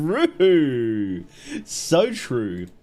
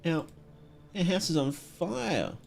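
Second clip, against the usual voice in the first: put-on soft, stammering little child voice, a touch of fear; a lightly voiced frightened kid stuttering through it; scared child; 3 semitones higher, 6 dB quieter than usual. Reading crying child's dialogue.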